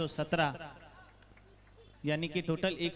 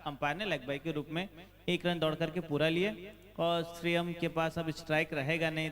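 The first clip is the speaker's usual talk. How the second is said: ordinary delivery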